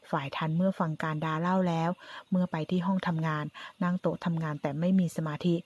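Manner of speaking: plain reading voice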